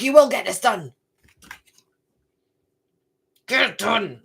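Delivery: a hint of a stern matron giving orders; lightly voiced strict taskmaster, firm; stern teacher. Card's stern matron barking orders at the reader, lightly voiced. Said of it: "How stern she is".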